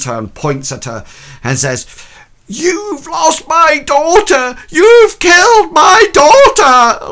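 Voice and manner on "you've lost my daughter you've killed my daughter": screamed much higher and louder, slow and unhinged; hysterical accusing shriek. Acted. Grieving mother accusing the governess, shrieking and hysterical. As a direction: shriek it out like a mother gone mad. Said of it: "Like this".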